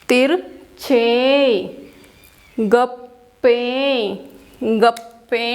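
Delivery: तिरछे and गप्पे broken slowly into syllables, last vowel held; pronunciation demo. Shown as a demonstration. Teacher shows children how hard poem words are said, careful syllable-by-syllable pronunciation. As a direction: sound out तिरछे, गप्पे part by part with pauses. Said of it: "उच्चारण सीखते हैं".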